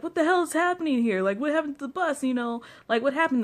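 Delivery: high, fast, baffled driver; confused bus driver. Retold or imitated. Bus driver finding his bus moved, high and baffled. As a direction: play the driver who lost his bus. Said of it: "he's just like".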